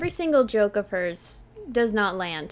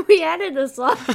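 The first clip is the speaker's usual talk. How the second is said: laughing, mouth full; giggling out the explanation; 5 semitones higher, 5 dB louder than usual. Real, not acted. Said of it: "Sorry, I'm eating one right now".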